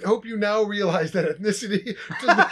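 breaking into laughter mid-sentence; trying to talk through a rising laugh. Real, not acted. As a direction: start cracking up as you say it. Cracks up during joke sign-off.

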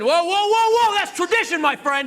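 high, loud whoa whoa; loud protest. Acted. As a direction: shout it down big and high. Calling out in defense.

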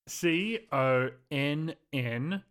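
slow letter by letter; spelling it out. Real, not acted. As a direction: spell each letter slowly. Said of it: "spelling bee letters".

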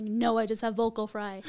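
laughing through the line; giggling retort. Retold or imitated. Retelling her reply, laughing.

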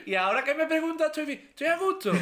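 high, giggly parody of his friend's words; mocking imitation. Friend parodies the guest's claim to be fine, mocking and high. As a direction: tease him by echoing his own line back.

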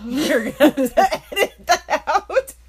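high, loud, helpless laughter through the words; laughing. Real, not acted. Co-host to podcast partner after birth remark, laughing. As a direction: barely get it out through laughing.

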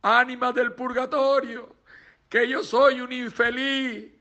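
high, loud, hammed-up wail of fear; terrified pleading. Fearful man begs the souls of purgatory, hammed-up terror. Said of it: "el pobre miedoso dice".

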